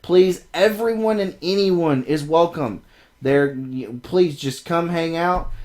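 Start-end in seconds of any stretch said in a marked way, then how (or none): none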